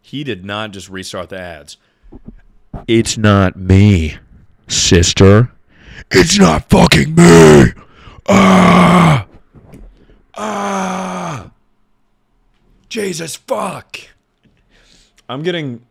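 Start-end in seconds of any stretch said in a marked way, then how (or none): 2.9-7.7 loud, slow yelling at the screen; shouting. Real, not acted. Shouting at ads complaint. 8.3-14.0 high pained cries from burning mouth; pained yell. Real, not acted. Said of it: "hot sauce on lips".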